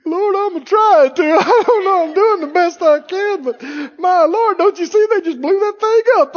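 high, hammed-up crying plea; mock tearful whining. Believer answering the Lord about bad news, mock tearful whining. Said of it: "Would you look at him and go".

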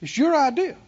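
ordinary talk, nothing marked

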